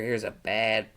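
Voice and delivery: plain reading voice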